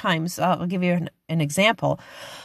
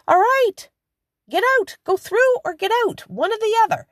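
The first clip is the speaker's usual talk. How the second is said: impatient crowd. Retold or imitated. Impatient people at revolving door.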